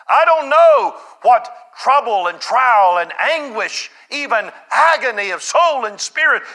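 loud, high-pitched sermon delivery; full-force preaching. Real, not acted. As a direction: preach it out hard, voice lifted high.

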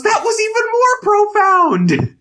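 very high, loud and slow, laughing as she says it; mock amazed Jill. Co-host plays Jill marveling at Tim, squealed through laughter. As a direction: squeal it out in delighted mock awe. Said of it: "and Jill goes".

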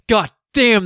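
loud relieved exclamation; loud whoop of relief; elated shout. Retold or imitated. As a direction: burst it out with relief. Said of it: "he runs out like".

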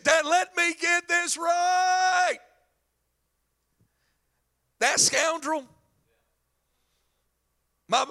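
high, indignant outburst to his father; hammed-up jealous brother's protest; mad older son. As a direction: sputter in outraged disbelief, drawing words out. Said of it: "And the son's mad".

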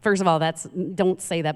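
ordinary talk

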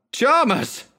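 high, desperate cry of his name; cried out. A narrator's reading. Narrator cries out to his raving friend, high and urgent. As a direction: call him back from madness. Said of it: "I cried".

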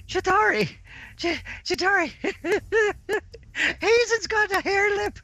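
high wheezing laughter; laughing taunt. Acted. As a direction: shriek it through helpless laughs. Player acts wheezing laughing taunt.

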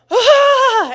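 loud, high burst of exasperation; frustrated scream. Retold or imitated. Mom losing her temper during math lesson, frustrated scream. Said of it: "I lost my temper".

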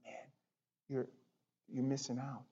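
soft, low plea; gentle, not mad. Acted. Pastor telling his flock they are missing out, gently. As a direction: drop to a hushed, concerned appeal. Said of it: "I'm not mad at you".

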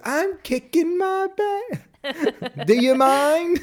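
very high, drawn-out mock straining; Fletcher. Answering the man in the restroom, hammed-up imitation. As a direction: squeal it slowly in an over-the-top imitation. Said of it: "And he goes".

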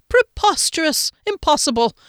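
high, emphatic scoffing exclamations; indignant dismissal. A narrator's reading. Man refusing to believe it, indignant dismissal. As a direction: wave it away indignantly. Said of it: "He dismissed it with a wave".

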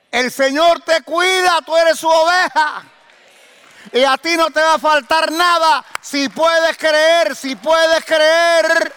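high, loud shouted proclamation; full-force preaching. Real, not acted. Preacher to his congregation about God's care, shouting high and loud. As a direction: thunder the promise over the congregation. Preacher reassures flock, peak of sermon.

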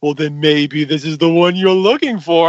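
building mock-sinister shopkeeper, ending in a loud Ah; hammy villain reveal. Acts sinister shopkeeper revealing mask.

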